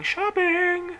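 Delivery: high, sustained, stretched-out "shopping"; gleeful drawn-out singsong. Real, not acted. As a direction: sing it out with glee, holding it long. Elated about going shopping.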